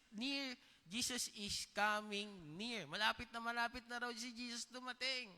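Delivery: high, loud, slowed pulpit delivery; full-force preaching. Acted. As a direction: preach it out to the whole church, drawn out. (masigasig na pangangaral sa pulpito)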